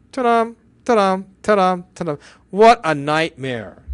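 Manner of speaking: usual speaking voice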